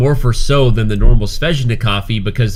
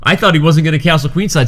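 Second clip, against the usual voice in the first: ordinary talk, nothing marked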